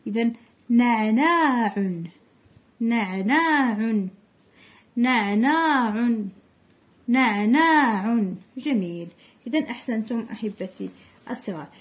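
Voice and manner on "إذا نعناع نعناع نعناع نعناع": نعناع read slowly with each ع clear; slow choral reading. Shown as a demonstration. Teacher reading نعناع slowly with the class. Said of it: "نقراو الكلمات اللي كتبنا".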